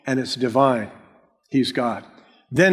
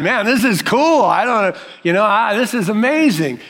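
higher-pitched, wowed visitor; awed amazement; 4 semitones higher than usual. Acted. Preacher acts out a Disneyland visitor, awed and excited. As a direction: play someone marvelling, lost for words.

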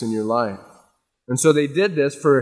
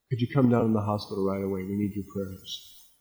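mock frantic phone plea to the pastor, softer; anxious caller; 5 semitones lower, 8 dB quieter than usual. Churchgoer phoning the pastor from the hospital, quiet and pleading. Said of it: "on the phone calling the pastor".

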